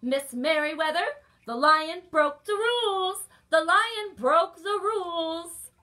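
indignant loud report; loud tattling. Acted. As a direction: tell on the lion as loud as you can. Tattling loudly while hurrying.

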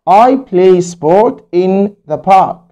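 each word of the sentence slowly spelled out; slow model reading. Shown as a demonstration. Teacher reads the finished sentence slowly to pupils. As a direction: read it slowly for the class to copy. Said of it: "teacher reads model sentence".

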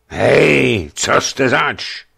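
loud, slow challenging call; hoarse call. Acted. Sleepy gate guard challenging strangers, hoarse call. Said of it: "zvolal chraptivým hlasem".

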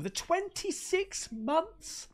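slow amazement. Real, not acted. Reads a long membership slowly.

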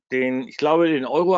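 ordinary talk

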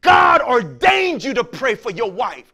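loud, slow preaching; a preacher's slow hammering shout; preached shout. Acted. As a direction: drive it home to the men. Preaching at full force.

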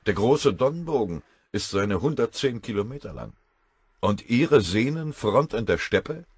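put-on a touch of a wounded old colonel; lightly voiced colonel. Colonel defends himself to his general, a touch hurt.

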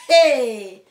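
loud, whooping hey; excited shout. Real, not acted. Celebrating the first YouTube equipment, excited shout. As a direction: burst out with a loud, drawn-out cry. Excited outburst opening the vlog.

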